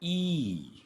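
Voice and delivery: long ई said clearly; teacher sounds ई; model sound ई. Shown as a demonstration. Teacher modeling the vowel ई for learners. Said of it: "इसे पढ़ा जाता है".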